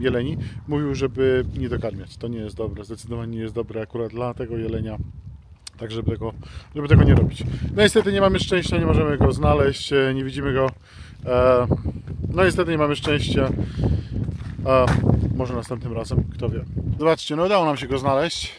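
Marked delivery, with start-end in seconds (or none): none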